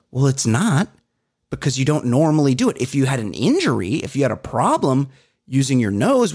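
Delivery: mock exasperation rising to a shrill peak; rising mock rant. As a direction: start reasonable, then build to a high disbelieving rant. Retold rant at mouth breather.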